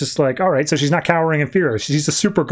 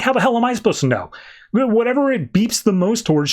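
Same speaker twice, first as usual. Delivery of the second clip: higher, fast mock exasperation; exasperated Lex; 7 semitones higher, faster than usual. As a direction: snap back, fed up, up high. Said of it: "he's like".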